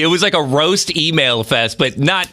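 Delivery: ordinary talk, nothing marked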